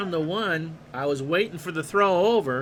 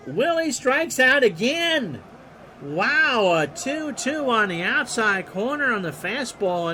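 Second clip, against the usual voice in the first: higher and louder, punch out the call; excited strikeout call; 7 semitones higher, 4 dB louder, slower than usual. Acted. Announcer calling the strikeout, excited. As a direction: shout the strikeout with a burst.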